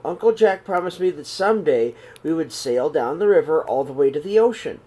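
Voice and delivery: plain reading voice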